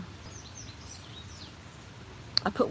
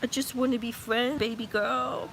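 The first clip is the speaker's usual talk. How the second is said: higher pet voice for the bunny; voicing the male bunny; 5 semitones higher than usual. Voicing Beano to his mate, higher pet voice. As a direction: speak as the lovesick buck. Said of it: "He's like".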